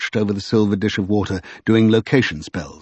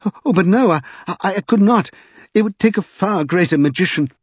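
high, stammering refusal; horrified protest; 9 semitones higher than usual. A narrator's reading. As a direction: back out, flustered and aghast. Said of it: "exclaimed Mister Secundus in horror".

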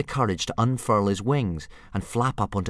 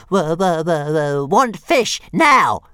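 much higher, louder squealing dragon; demanding little dragon; 9 semitones higher, 11 dB louder, slower than usual. Acted. Baby dragon Toothless squealing demands for fish. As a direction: screech for fish like a greedy hatchling.

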